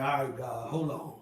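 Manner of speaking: a quiet, startled "hold on"; hushed aside to God. Retold or imitated. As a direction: mutter to God under your breath.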